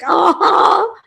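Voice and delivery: high, loud knowing uh-huh; delighted high-pitched yes; gleeful uh-huh. Retold or imitated. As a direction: squeal it, finally getting the joke. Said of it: "I was like".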